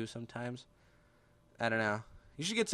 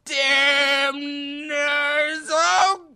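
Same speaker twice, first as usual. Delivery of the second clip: high, loud drawn-out cry; yelled line; 12 semitones higher, 10 dB louder, much slower than usual. Acted. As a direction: holler it slowly, pitch up high.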